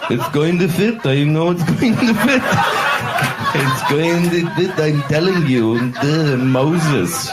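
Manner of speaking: put-on stubborn passenger voice.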